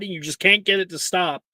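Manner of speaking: usual speaking voice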